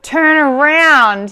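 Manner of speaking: slow, drawn-out yell; yelling at myself. Retold or imitated. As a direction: bark the order at yourself under cold water. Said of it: "I yell at myself".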